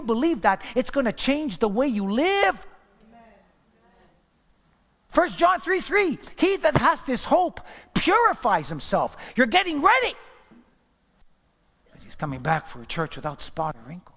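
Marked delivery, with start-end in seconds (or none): 5.1-10.2 high, loud preaching build; rising sermon cry. Acted. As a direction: preach it out with fire. Preaching at full force.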